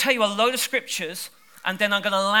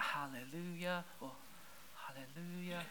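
a barely-there, softer hallelujah with a laugh; feeble hallelujah; 19 dB quieter than usual. Shown as a demonstration. Preacher showing the wrong kind of praise, feeble and soft. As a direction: say it like you hardly mean it. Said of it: "is not a hallelujah".

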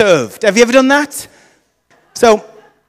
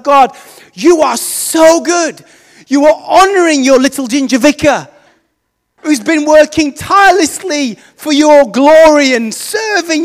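higher, louder, hammed-up thankful prayer; mock grateful prayer; 6 semitones higher, 4 dB louder than usual. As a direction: thank God like you've won the lottery. Gloating thank-you prayer to God.

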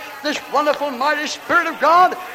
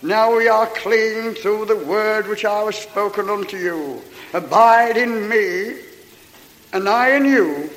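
preaching scripture; 3 semitones lower than usual. Acted. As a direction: proclaim each verse to the crowd. Preaches John fifteen at full force.

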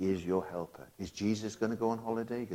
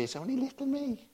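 much higher, mocking small voice; mock timid voice. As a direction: squeak it like a timid doubter. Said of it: "But you say".

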